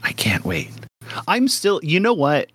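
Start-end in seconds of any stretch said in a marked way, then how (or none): 0.0-0.7 low, hushed whisper of anticipation; eager whisper. Real, not acted. Host gushing over upcoming characters, eager whisper. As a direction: whisper it, as if overcome.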